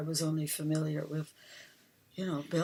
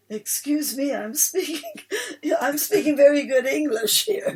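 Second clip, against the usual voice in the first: high, laughing mock Cockney accent; 8 semitones higher, 7 dB louder than usual. Mimicking a Cockney newsagent, laughing through a Cockney accent. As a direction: put on a jokey Cockney voice while laughing. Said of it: "this guy had quite a strong Cockney accent".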